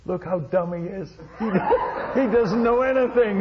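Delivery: mocking while laughing, pitch rising; laughing mockery. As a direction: chuckle through the insult. Native mocking the visitor, crowd laughing.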